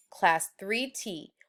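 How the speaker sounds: ordinary delivery, nothing marked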